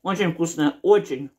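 talking between bites; chewing while speaking; mouth partly full. Real, not acted. Seen 4: cutting food with fork, mouth likely partly full between bites.

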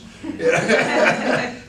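bright burst of laughter; real hearty laugh. Real, not acted. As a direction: laugh out loud at the joke. Teacher laughs at own joke.

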